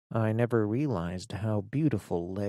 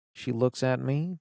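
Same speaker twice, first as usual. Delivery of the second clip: usual speaking voice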